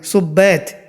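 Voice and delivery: "सुब्बैत" begun with "su", no whistled s; correct "su" sound. Shown as a demonstration. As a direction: say "सुब्बैत" opening on a short u. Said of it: "इसको सू से बोलना है". ("सुब्बैत" सू से, बिना सीटी के)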